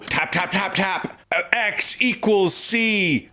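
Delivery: "X equals C" drawn out slowly on a high pitch; mock lecturer hammering "X equals C" high and slow; teacher's emphasis. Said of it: "They'll always do more emphasis".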